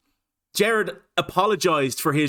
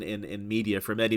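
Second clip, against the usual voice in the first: ordinary talk, nothing marked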